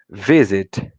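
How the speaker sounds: "visit" with VI hit hard; stress on first syllable. Shown as a demonstration. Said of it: "o início da palavra que for a parte mais forte".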